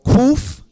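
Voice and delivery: Kuf called out high and loud; emphatic Kuf. Shown as a demonstration. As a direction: announce Kuf with a sharp lift. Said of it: "Here's another letter".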